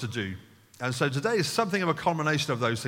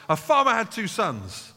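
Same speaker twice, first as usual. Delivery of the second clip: storyteller opening as Jesus; 6 semitones higher, 4 dB louder than usual. Retold or imitated. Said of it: "As Jesus gets up and says".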